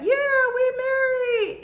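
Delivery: very high, loud, drawn-out cheer; exaggerated high-pitched whoop of an announcement; mock announcement. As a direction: squeal it out slowly like hammed-up big news. Mimics announcing the marriage.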